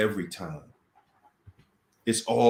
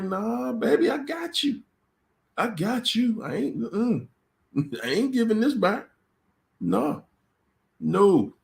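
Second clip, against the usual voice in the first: high, sing-song taunting refusal; teasing narc; 12 semitones higher than usual. Retold or imitated. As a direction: taunt the victim, much higher. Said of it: "the narc is like".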